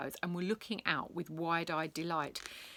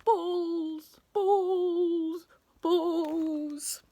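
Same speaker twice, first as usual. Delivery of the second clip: high, drawn-out loopy chant; obsessive, pitched-up babble to himself; dazed repeating; 12 semitones higher, 5 dB louder, much slower than usual. A narrator's reading. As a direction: keep repeating it as if gone bananas. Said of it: "he carried on muttering".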